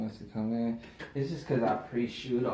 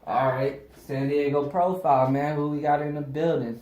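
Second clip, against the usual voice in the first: upbeat show intro; 3 semitones higher, 6 dB louder than usual. Real, not acted. Host opens the show segment.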